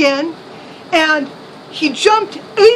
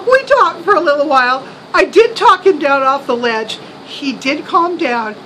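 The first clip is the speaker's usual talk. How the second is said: usual speaking voice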